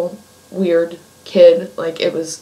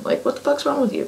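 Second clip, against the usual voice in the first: incredulous; 9 semitones higher, 5 dB quieter, faster than usual. Retold or imitated. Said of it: "And I'm like".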